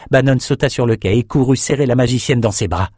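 ordinary delivery

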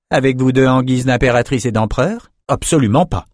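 usual speaking voice

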